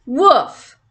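a light mock woof like a small dog; dog bark. Lola the dog barking at the girls, light mock woof. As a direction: bark the word like a little dog. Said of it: "Lola barked".

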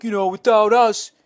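high whiny fan voice; a hammed-up entitled fan; mock entitled fan. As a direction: whine it like an indignant fan. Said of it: "someone's like".

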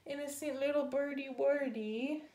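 high, lightly whimpered lament; mock crying dog. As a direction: pretend tearful baby talk. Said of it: "he cried".

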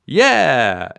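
loud happy cheer; yelled. A narrator's reading. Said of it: "everybody yelled".

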